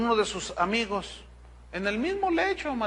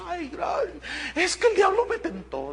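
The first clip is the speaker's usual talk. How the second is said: voz aguda de mujer que llora, a medias; mujer llorando imitada; 9 semitones higher than usual. Retold or imitated. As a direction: imita a la mujer entre lágrimas, voz alta. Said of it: "Y ella lloraba". (voz de mujer llorosa imitada, aguda)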